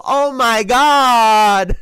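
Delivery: very slow, high, loud drawn-out "Oh, my God"; laughing hammed-up impression; mock Cat exclamation. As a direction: stretch it out, imitating him. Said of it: "Cat wanna go".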